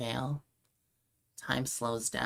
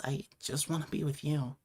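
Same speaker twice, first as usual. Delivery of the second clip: ordinary talk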